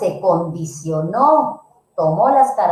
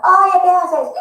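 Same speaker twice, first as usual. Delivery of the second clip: mock father's yell; 8 semitones higher, 4 dB louder, slower than usual. Father yells at child.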